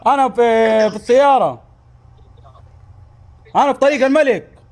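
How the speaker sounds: shouting into the phone; loud, blaring voice; too loud, headphones on. Acted.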